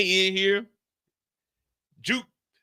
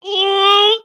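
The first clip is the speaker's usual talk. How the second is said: squealed high ooh; high loud ooh; 12 semitones higher, 9 dB louder than usual. Retold or imitated. Juke swooning, squealing a high ooh.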